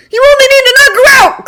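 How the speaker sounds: very high loud call. A narrator's reading. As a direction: call out across the fight. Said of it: "Clint called out".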